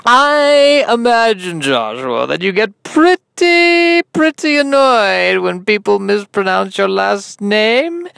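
high, slow, gushing interviewer; hammed-up host prying theatrically; Sid Spielman. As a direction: swoop up high and stretch the words. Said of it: "I'm your host, Sid Spielman".

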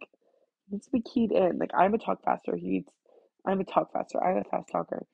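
usual speaking voice

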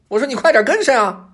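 high, loud fox shout; impatient high-pitched call to follow; calling back. A narrator's reading. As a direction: yell bossily over your shoulder. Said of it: "还不忘了回头喊老虎".

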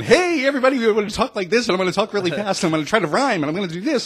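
high, loud, fast hype radio DJ; rapid-fire rhyming DJ patter, pushed high; puking DJ voice. Retold or imitated. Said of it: "And puking is when".

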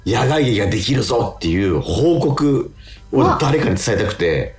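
ordinary talk, nothing marked

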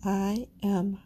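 slow, calm affirmations with pauses; affirmation reading. Acted. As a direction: speak each affirmation slowly, meaning it. Leads listeners through affirmations.

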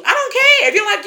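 high and laughing; excited high voice. Real, not acted. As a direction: pitch up with excitement.

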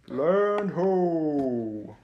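loud high call of land sighted; shouting from crow's nest. Acted. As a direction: call it out from the mast top. Lookout calls from crow's nest.

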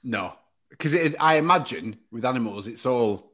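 ordinary talk, nothing marked

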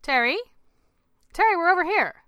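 high, loud call across a distance. A narrator's reading. Wife calling her husband across the beach, loudly. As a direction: call over to someone who is lost. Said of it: "heard his name being called from across the beach".

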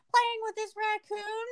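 put-on high, drawn-out child voice; kids' singsong answer. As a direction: imitate kids answering, slow and high. Children answer in retold story.

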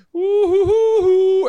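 high, slow, loud woo-hoo-hoo; gleeful taunting whoop; sly hooting. Acted. The snake creeping up on its prey, gleeful hooting.

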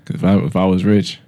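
ordinary talk, nothing marked

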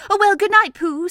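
high, loud farewell; Piglet bidding goodnight. A narrator's reading. Piglet parting at Pooh's house, high loud goodnight.

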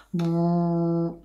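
a low, easy bu in the chest. Shown as a demonstration. Singing teacher demonstrating a low comfortable tone. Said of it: "para mí puede ser".

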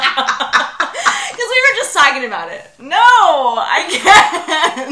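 high, loud, slow words through laughter; real helpless laughter. Real, not acted. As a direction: barely get it out while cracking up. Cracking up at own joke.

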